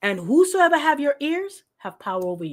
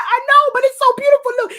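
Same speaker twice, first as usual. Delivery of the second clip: much higher, louder, swooning voice; gushing insistence; 13 semitones higher, 8 dB louder, faster than usual. Retold or imitated. Defending the movie to Apostle, high loud swoon. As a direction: insist it's beautiful, all gushy. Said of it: "I'm like".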